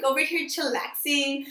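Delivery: ordinary delivery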